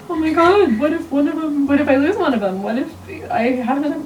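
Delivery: high, anxious what-ifs tumbling out; terror mode. Retold or imitated. Pregnant mother imagining losing a twin, a panicky high voice. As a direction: voice your panicked spiral of worries. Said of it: "in complete and total terror mode".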